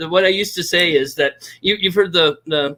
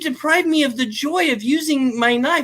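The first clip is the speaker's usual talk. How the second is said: high-pitched, playfully wronged protest; mock indignant plea; 11 semitones higher than usual. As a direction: plead in mock outrage, much higher. Mock plea against lending his knife.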